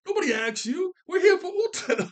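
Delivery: very high, loud mock heckling; hammed-up squeaky shout-down; arguing crowd shout. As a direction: yell back like a heckler in a squabbling crowd. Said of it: "crowd starting to argue amongst themselves".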